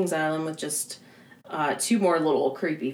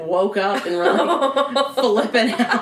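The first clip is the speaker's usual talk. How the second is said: louder, higher, laughing through it; 5 semitones higher, 6 dB louder, slower than usual. Real, not acted. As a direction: tell it while cracking up. Laughing while telling.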